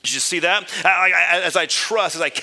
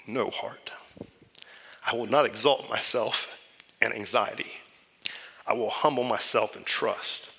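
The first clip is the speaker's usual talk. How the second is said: quiet self-talk; 4 semitones lower, 5 dB quieter than usual. Acted. Preacher voices his own prayer.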